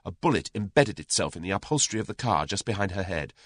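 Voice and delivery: ordinary delivery, nothing marked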